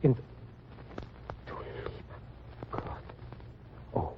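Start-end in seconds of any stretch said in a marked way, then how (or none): 1.5-3.0 breathless whispered shock; whispered grief in German; stunned whisper. Acted. Husband hears wife died. 3.9-4.1 stunned grief. Acted. Husband hears wife died.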